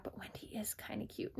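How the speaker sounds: breathy whispered aside to herself. Retold or imitated. Tink whispering to herself about Wendy. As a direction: whisper it as a secret second thought.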